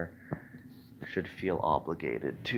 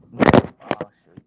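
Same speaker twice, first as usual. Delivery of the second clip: ordinary talk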